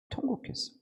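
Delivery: breathy whisper of the word 통곡했어, no voice; whispered wail. Shown as a demonstration. Pastor telling how Hezekiah wept, hushed whisper. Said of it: "심히".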